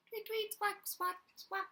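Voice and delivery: high chirpy bird squawks; bird tweets. Acted. Reading a story to children, squawking like the bird. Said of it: "It tweeted and squawked".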